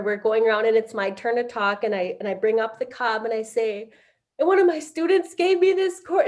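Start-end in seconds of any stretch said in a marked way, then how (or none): 4.4-6.3 high choked voice, about to break; retelling the talking circle, choked with tears; losing it, crying. Retold or imitated. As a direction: voice cracking as tears come. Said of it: "and I lost it and I couldn't even".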